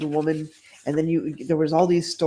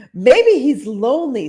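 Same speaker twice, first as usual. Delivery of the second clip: Blanche voice; 7 semitones higher, 7 dB louder than usual. Acted. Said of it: "said Blanche".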